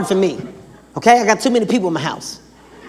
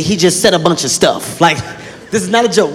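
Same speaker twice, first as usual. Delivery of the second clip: usual speaking voice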